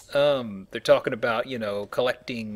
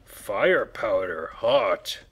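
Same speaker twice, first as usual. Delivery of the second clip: slow, loud caveman grunt-talk; very slow and loud, like a cartoon caveman; 2 semitones higher, 7 dB louder, much slower than usual. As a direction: speak like a dim caveman, word by word. Caveman joke about gunpowder.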